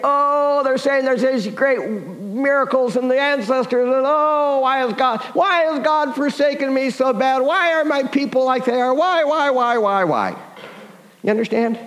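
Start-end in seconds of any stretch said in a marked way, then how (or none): 0.0-10.4 grumbling. Acted. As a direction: wail it out as an exasperated grumbler, pitched up. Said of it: "He's grumbling. He's saying".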